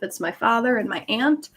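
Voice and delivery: normal talking voice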